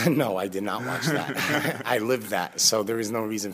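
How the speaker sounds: laughing through the answer; amused laughing reply. Real, not acted. Guest answers whether he watched his own documentary, laughing.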